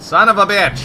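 loud, high outburst of frustration; exasperated curse. Real, not acted. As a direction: swear out loud at your own mistake.

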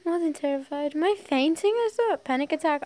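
dramatic alarm climbing in pitch; rising panic. A narrator's reading. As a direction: build the panic through each question. Narrator's rising panic in story.

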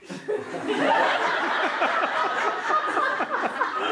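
breaks into high giggles; real giggling fit; high laughing. Real, not acted. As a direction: laughs hard mid-story. Narrator cracks up.

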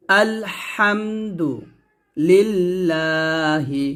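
slow chanted tajweed reading of আলহামদুলিল্লাহি; teacher's model recitation; slow Quran recitation. Shown as a demonstration. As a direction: recite it slowly and melodically, every letter clear. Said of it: "আবার বলেন".

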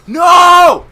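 very high, very loud no; panicked yell. Real, not acted. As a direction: scream the word out. Attacked in game.

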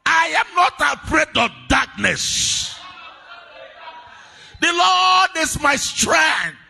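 loud, high fervent prayer over music; shouted prayer. Acted. Preacher praying over the church, a loud fervent prayer. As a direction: pray at full force, pleading.

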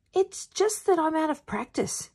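put-on high-pitched boy character voice; boy's excuse. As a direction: make excuses in a high young voice. Bruno making excuses in story.

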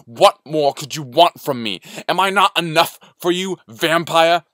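heated, rising to a high shout; indignant outburst building to a full shout; shouting in argument. A narrator's reading. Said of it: "They were shouting now".

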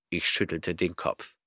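plain reading voice

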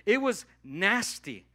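drawn-out loud "nasty" to make the point; emphatic nasty. Shown as a demonstration. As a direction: lean hard on the word nasty. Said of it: "I'm not kidding you".

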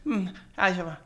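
half-formed sounds, trailing down; imitated sleep mumbling. Father imitating his son talking in his sleep, mumbling. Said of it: "like, mumbling in his sleep".

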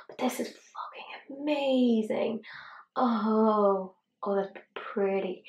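slow hushed whisper of awe; awed whisper. Real, not acted. Beauty vlogger marvelling at a shimmer, awed whisper. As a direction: breathe it out, amazed.

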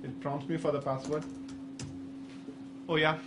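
ordinary talk, nothing marked

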